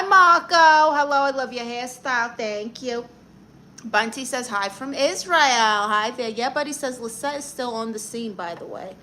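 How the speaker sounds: ordinary delivery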